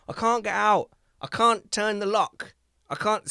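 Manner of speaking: put-on high, distressed little girl voice; stuck child. Daughter stuck in cubicle calling out, high child voice. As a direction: plead as a trapped child.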